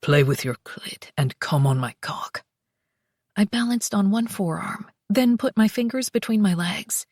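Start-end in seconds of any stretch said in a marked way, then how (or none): 0.0-2.5 low husky whisper; whispered male voice. Acted. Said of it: "he said between thrusts".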